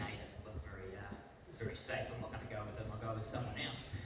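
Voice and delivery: a driver sizing up the passenger's photo, low and hushed; driver's doubtful thoughts. Retold or imitated. Driver judging his photo.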